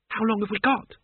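put-on a worried girl asking; nervous. A narrator's reading. Isabel to Mort on the horse, nervous. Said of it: "she said nervously".

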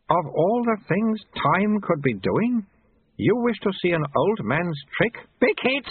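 put-on high, drawn-out question ending in a toddler squeal; high childlike elder; babyish abbot. A narrator's reading. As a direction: ponder slowly, then demand like a baby.

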